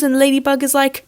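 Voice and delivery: normal talking voice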